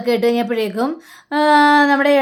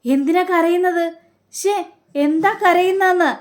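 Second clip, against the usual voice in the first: high, startled asking; why is he crying; startled concern; 7 semitones higher than usual. Retold or imitated. Family startled when the uncle starts crying, high and concerned.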